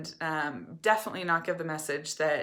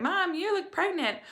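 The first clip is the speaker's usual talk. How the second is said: put-on high, innocent kid voice, much higher; imitating young kids; 12 semitones higher, 3 dB louder than usual. Mom imitating her young kids poking her stomach, high childlike voice. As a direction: blurt it out like a wide-eyed little child. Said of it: "they would poke my stomach and be like".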